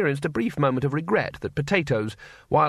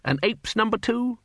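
self-pleased repeat; 10 semitones higher than usual. Acted. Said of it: "Rather clever, I thought".

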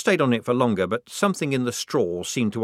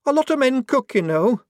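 much higher, louder indignant retort; hotly; 7 dB louder than usual. Acted. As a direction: snap back defensively. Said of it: "said Shufti hotly".

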